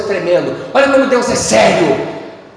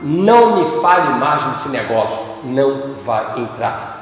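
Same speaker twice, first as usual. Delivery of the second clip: stern voice of God; 8 semitones lower, slower than usual. Acted. Deus recusa a Moisés.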